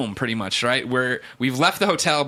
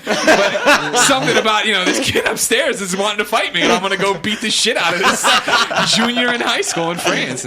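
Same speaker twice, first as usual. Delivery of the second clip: laughing while telling; 7 semitones higher, 5 dB louder than usual. Real, not acted. Retelling, laughing.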